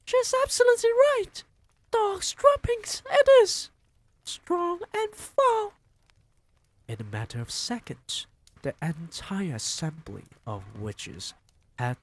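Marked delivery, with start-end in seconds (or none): 0.0-5.7 very high, loud witch screech; crying out. Acted. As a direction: screech it excitedly. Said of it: "cried another voice".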